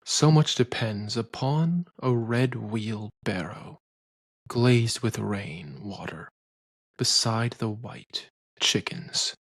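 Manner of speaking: slow, dropping recitation of the poem; poetry reading. Shown as a demonstration. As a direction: read it slowly, letting each line settle. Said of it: "Let's take his poem".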